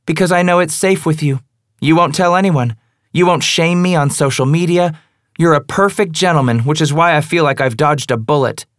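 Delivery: high, loud, mocking praise; sarcastic. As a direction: sneer each compliment. Female character, sarcastic.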